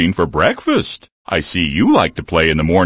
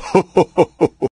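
gleeful laugh; 9 semitones higher than usual. Acted. Bear laughs after winning.

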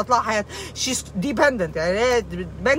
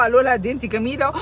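ordinary talk

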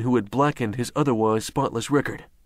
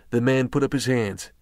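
usual speaking voice

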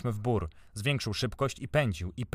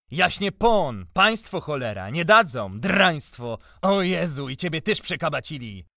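high, loud angry lament; bitter outburst; 6 semitones higher, 7 dB louder than usual. A narrator's reading. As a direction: rail loudly against them all. Enraged outburst at the gentry.

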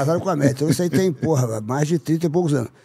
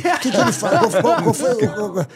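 higher, laughing through the words; laughing question; 5 semitones higher, 4 dB louder than usual. Real, not acted. Storyteller trying to recall the punchline, laughing. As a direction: ask while cracking up.

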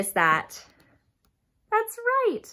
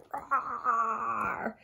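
monster roar; 5 semitones lower, 5 dB quieter than usual. Acted. Monster roar in picture book.

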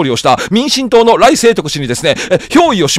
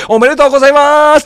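1 polite congratulations; 6 semitones higher than usual. Retold or imitated. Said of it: "って言ったんですよ".

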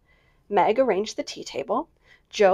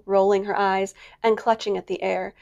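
plain reading voice

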